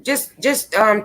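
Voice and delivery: usual speaking voice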